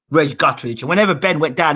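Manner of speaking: ordinary talk, nothing marked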